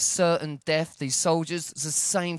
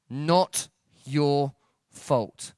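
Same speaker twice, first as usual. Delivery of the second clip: each word spaced far apart; slow, emphatic reassurance; 2 semitones lower, much slower than usual. Retold or imitated. Therapist driving it home to the kid, slow and emphatic. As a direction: say it very slowly, word by word.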